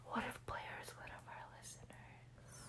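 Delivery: excited gasping whisper. Real, not acted. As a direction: whisper it in shock. Gasps then whispers.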